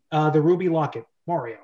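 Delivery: normal talking voice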